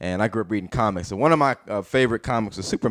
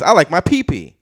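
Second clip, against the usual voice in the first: put-on high, louder little-boy voice; young son; 7 semitones higher, 5 dB louder than usual. Son to dad after trading compliments, high child voice. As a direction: proudly announce it like a small child. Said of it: "And then he says".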